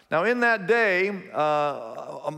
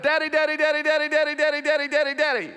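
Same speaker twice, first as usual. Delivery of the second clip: put-on much higher, rapid begging of a scared little boy; panicked child's plea, pitched up high; frantic pleading; 10 semitones higher, faster than usual. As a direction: squeal "daddy" over and over to escape a spanking. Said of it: "Just like that".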